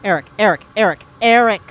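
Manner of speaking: calling a lost friend's name. Acted. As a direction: shout his name into the dead feed. Calling out to absent Eric.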